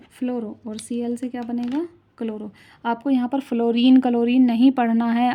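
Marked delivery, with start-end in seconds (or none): 2.9-5.3 raised voice, firm teacherly warning; louder, stressing what not to read; emphatic correction. Real, not acted. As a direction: correct the class loudly and firmly. Warns against the usual names.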